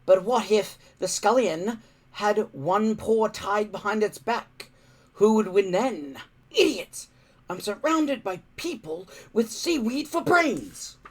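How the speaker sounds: lightly voiced crafty kid mocking; crafty boy. A narrator's reading. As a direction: tease it slyly, then sneer. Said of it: "said Warthog craftily".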